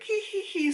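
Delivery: high, sly villain's tittering laugh; sneaky giggle. Retold or imitated. Character's smug giggle.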